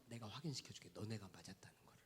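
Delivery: soft hushed whisper of a promise; whispered confidingly to the congregation. Acted. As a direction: lean in and breathe it.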